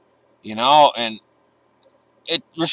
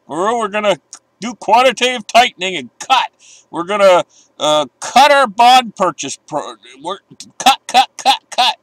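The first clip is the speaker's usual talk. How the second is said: higher and louder, sing-song mockery of officials; mocking Fed voice; 7 semitones higher, 4 dB louder than usual. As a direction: parrot the Fed's line like a broken record. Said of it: "that's all we've been hearing from them".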